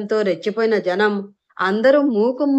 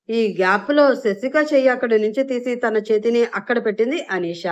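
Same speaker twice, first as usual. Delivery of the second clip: ordinary delivery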